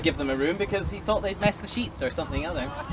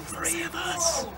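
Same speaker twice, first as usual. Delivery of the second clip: slow, much softer hushed voice; hushed sailors; 7 semitones higher, 7 dB quieter, slower than usual. Acted. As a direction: breathe it quietly, afraid to be heard. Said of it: "They whispered".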